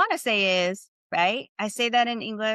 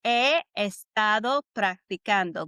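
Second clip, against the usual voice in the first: slow demo. Shown as a demonstration. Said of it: "by saying this".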